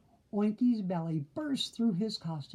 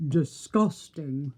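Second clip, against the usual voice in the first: aghast; 3 semitones lower, 4 dB louder, slower than usual. A narrator's reading. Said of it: "cried the aghast wolves".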